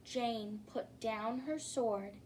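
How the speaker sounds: ordinary delivery, nothing marked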